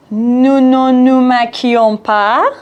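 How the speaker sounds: very slow, each syllable spaced out; slow drill. Shown as a demonstration. Teacher slowly modelling the negative conjugation. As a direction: spell out the sentence for learners. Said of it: "چقدر رلرلر شد".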